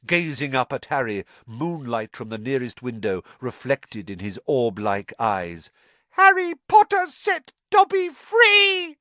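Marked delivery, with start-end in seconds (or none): 6.1-9.0 very high, loud joyful squeal; shrill elf. Acted. Freed house elf gazing up at Harry: shrill delight. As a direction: shriek with grateful joy.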